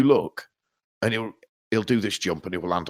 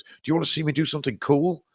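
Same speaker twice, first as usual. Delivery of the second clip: put-on quick and higher, a kid begging for attention; eager son voice; 8 semitones higher, faster than usual. Dad imitating his son on the trampoline, quick and high. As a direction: pitch it up like an excited little boy. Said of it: "And I turn around and goes".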